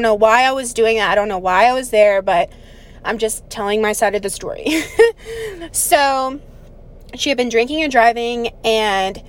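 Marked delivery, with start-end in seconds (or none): 4.7-5.7 laugh. Real, not acted. Laughs after telling her side.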